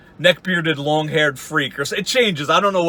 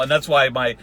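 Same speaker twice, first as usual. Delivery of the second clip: usual speaking voice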